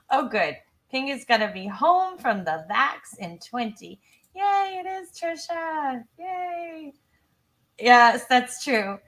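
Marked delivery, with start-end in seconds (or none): 4.3-6.9 high, happy little cheer; excited cheer. Real, not acted. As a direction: cheer along with a lifted voice. Cheering a viewer's news.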